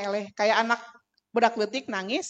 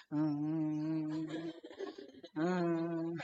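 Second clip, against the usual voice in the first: low, soft closed-mouth hum; low mock whimper, mouth closed; imitated child's "hmm"; 7 semitones lower, 9 dB quieter than usual. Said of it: "Manehna teh".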